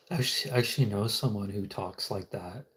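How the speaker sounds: ordinary delivery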